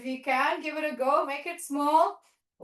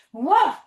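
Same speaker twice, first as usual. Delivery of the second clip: loud bouncy woof; playful dog bark; 8 dB louder than usual. Pretend. Teacher barking with the kids as a dog pack, loud and playful. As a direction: bark like a happy puppy.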